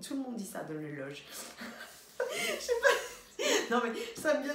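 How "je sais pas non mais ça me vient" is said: words squeezed out through high laughter; laughing. Real, not acted. Cracking up at her own fake accent, laughing through it. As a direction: giggle through the line.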